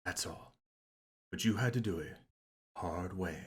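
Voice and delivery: put-on hushed Desperado line, Mexican-accented; Antonio Banderas Mexican accent. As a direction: deliver it softly in a Mexican accent, with long pauses. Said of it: "is Antonio Banderas doing a Mexican".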